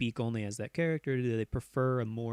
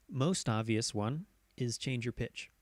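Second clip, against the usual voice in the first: ordinary talk, nothing marked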